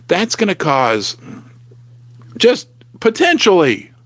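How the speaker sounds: ordinary delivery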